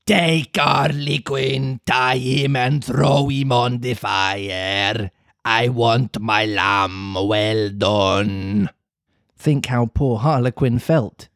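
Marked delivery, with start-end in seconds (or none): 0.0-8.7 slow, gruff villain order; slow gruff villain commanding his guards; hoarse yell. Acted. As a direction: bark the order like a hungry ogre. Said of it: "yelled at them in a hoarse voice".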